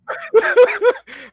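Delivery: loud, high laugh; big laugh. Real, not acted. Laughs at own tease.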